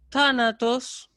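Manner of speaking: "tánatos" pitched high with no drop after the accent; no tonal descent. Shown as a demonstration. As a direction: keep "tánatos" up, without letting it fall. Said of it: "ahí no se produce ningún descenso". ("tánatos" agudo, sin ningún descenso tonal)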